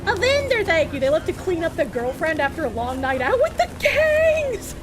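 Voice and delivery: ordinary talk, nothing marked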